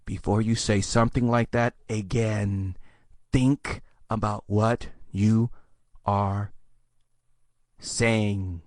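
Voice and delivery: low, slow and quiet; stern rebuke. Acted. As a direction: spell out the scolding with long pauses. Deadpan rebuke after mock hysteria.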